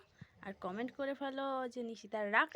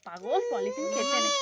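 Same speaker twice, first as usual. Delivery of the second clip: higher, slow cooing at the little one; 12 semitones higher, 4 dB louder than usual. Real, not acted. Mother coos high to her toddler eating chocolate.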